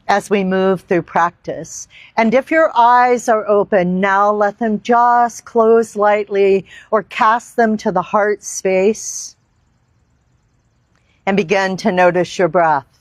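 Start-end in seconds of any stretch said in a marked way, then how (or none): none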